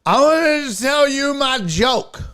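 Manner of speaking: high, slow, loud whiny heckler; pushy drawn-out heckler plea; dopey heckler. Retold or imitated. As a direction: play the clueless pest who won't leave. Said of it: "And he goes".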